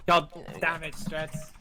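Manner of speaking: loud exasperated curse; frustrated. Real, not acted. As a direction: blow up in annoyance. Frustrated outburst.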